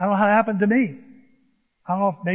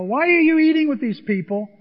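mock Pharisee challenge; 8 semitones higher, 5 dB louder than usual. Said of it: "Pharisees are saying things like".

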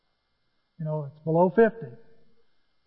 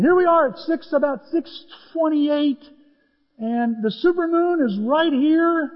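high, then slowing down; building the story with raised pitch; excited; 9 semitones higher, 4 dB louder than usual. Real, not acted. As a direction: paint the scene with wonder. Setting the moonlit golf scene.

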